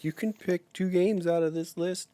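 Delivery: mock apologetic corporate voice; hammed-up pleading company. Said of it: "they're like".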